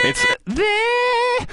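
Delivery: a sudden sky-high sung note; high falsetto. Shown as a demonstration. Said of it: "I can sing that".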